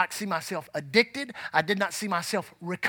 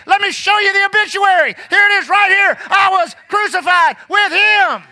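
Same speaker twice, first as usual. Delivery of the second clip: high shouted proof, slowing at the end; shouting the obituary back; triumphant shout; 10 semitones higher, 9 dB louder than usual. Acted. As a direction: bellow it like a victory. Preacher acting triumphant retort.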